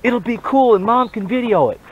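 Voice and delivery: put-on high, pleading kid voice. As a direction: whine it like a child egging dad on. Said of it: "to go down and say".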